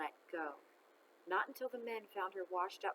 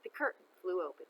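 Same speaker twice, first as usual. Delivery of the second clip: usual speaking voice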